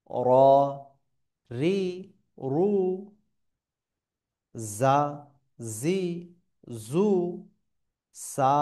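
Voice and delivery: isolated Arabic letter sounds, drawn out and mostly loud; teacher modelling syllables in slow, firm chant-like steps; pronunciation drill. Shown as a demonstration. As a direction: recite each syllable separately, vowel stretched. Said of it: "আপনাদেরকে উচ্চারণগুলো আমি একবার বলে দিই".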